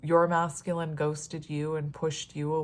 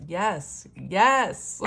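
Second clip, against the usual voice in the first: sassy yes; 2 semitones higher, 6 dB louder than usual. Real, not acted. Reader hearing a message to viewers, sassy cheer. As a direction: cheer it out with sass. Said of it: "I don't talk like this".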